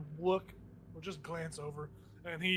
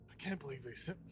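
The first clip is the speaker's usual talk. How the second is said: muttering; 7 dB quieter than usual. Acted. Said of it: "start to mutter".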